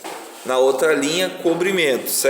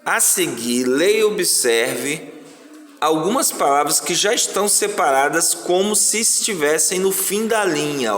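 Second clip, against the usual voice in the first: ordinary talk, nothing marked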